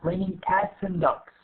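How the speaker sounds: AND reduced to 'n' between cats and dogs; reduced form. Shown as a demonstration. Teacher illustrates the rule, AND as just 'n'. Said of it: "بنحاول نقلبها ل N".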